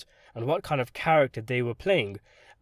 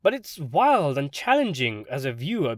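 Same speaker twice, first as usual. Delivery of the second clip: ordinary delivery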